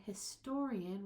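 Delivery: "historian" with TOR punched; stress on TOR. Shown as a demonstration. Teacher emphasizing the shift, stress on second syllable. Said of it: "we're gonna emphasize this a little bit".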